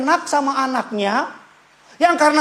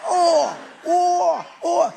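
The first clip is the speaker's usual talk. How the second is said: higher, strained mock whine, half laughing; child writhing wail; 8 semitones higher, much slower than usual. Father imitates son arching in his arms, laughing mock whine. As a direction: squirm and whine like a thrashing toddler. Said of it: "dia melenting".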